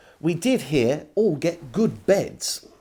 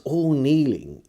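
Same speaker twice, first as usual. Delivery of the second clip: ordinary delivery, nothing marked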